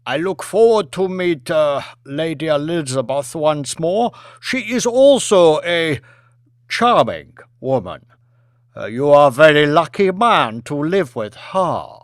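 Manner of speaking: put-on polite premier in a Chinese accent; a formal Chinese dignitary thanking his host. Premier flatters Lady Elizabeth.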